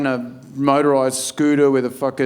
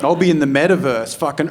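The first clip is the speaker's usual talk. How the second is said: ordinary talk, nothing marked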